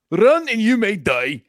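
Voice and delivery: high, loud, slow mock Scottish battle cry; bad Mel Gibson Scottish accent. Cohost mocking a Braveheart speech, hammed-up bad Scottish accent. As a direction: ham up a Braveheart-style Scottish brogue. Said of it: "In a really bad accent".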